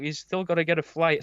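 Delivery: ordinary talk, nothing marked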